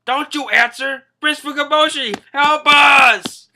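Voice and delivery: high, full-volume cry for help; hammed-up desperate yell; mock yelling. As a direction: shout it into the phone, building to the plea. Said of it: "I don't know who's yelling".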